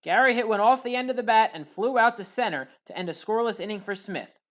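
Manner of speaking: usual speaking voice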